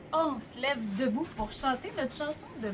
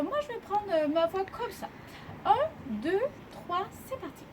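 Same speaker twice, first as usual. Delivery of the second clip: lightly raised big-sister pitch; higher girlish counting-in; 6 semitones higher than usual. Shown as a demonstration. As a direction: pitch it up a little like an older sister. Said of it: "d'une grande sœur, un peu plus aiguë".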